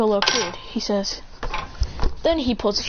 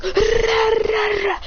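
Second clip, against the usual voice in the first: long, high rolled roar; mock battle roar; 12 semitones higher, much slower than usual. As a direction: growl it out high, mimicking a frantic gamer. Straining to beat a game level.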